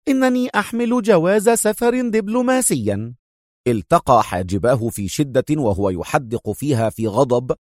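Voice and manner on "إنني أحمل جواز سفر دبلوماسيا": much higher, stern interruption; stern woman. Acted. The major cutting off the inspector, stern and high. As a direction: cut him off sternly. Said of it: "قاطعته في صرامة".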